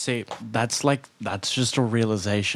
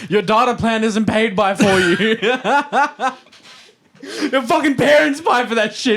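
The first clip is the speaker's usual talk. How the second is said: higher, louder, laughing scornful rant; louder, higher laughing mock rant; joining the rant; 10 semitones higher, 5 dB louder than usual. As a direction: pile on mockingly, half laughing. Joins the mock Tate rant.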